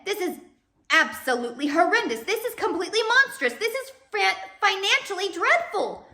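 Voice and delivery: very high, loud spluttering outrage; outraged banker. Acted. Mr Poe spluttering in horror at the marriage, high and loud.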